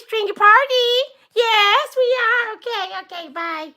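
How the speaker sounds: high, loud hammed-up voice; excitable skit character. Skit character announcing a stringy party, excitable. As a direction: squeal it giddily, easing off at the goodbye.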